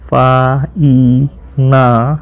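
ફા-ઈ-ના- drawn out very slowly, one syllable at a time. Shown as a demonstration. Teacher breaking a hard word into syllables for students, very slowly. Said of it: "આનો spelling ખાસ જોજો".

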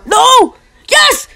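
high-pitched exclamations; excited outburst. Real, not acted. Gamer reacting to a near goal, excited outburst. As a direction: blurt it high as the play turns.

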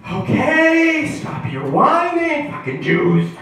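high-pitched exasperated God; exasperated scolding. Acted. God telling Abraham to quit complaining, exasperated.

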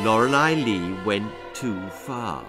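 ordinary delivery

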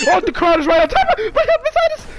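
high, loud excited rush. Real, not acted. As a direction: blurt it out thrilled. Spots crown nearby.

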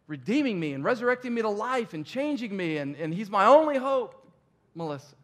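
impassioned plea. Retold or imitated. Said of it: "And I'm going".

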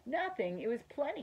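astonished, admiring objection; amazed protest. A narrator's reading. Boy amazed by mouse's modesty.

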